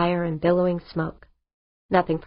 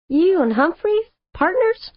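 high-pitched, louder disbelieving questions; incredulous; 11 semitones higher, 4 dB louder than usual. A narrator's reading. Pilot learning her boss joined his rival, incredulous. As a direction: pitch leaps up in astonishment. Astonished at news of partnership.

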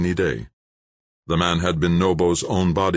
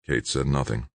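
plain reading voice

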